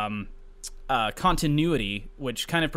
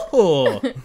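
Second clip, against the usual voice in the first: Mario hoo-hoo; 4 dB louder than usual. Acted. Mario hit.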